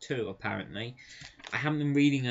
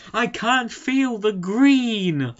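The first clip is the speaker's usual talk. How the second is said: much higher, louder, very slow anguished cry; hammed-up despairing hero; 10 semitones higher, 10 dB louder, much slower than usual. Green Lantern mourning his lost powers, hammed-up wail. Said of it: "fantastic lines from Green Lantern".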